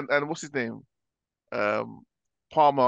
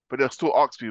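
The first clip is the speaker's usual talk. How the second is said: normal talking voice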